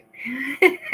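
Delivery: high bubbling giggle. Real, not acted. Streamer to chatter Eggy, giggling. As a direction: laugh at the chatter.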